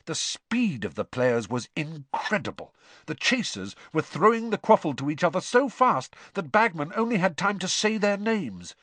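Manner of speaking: usual speaking voice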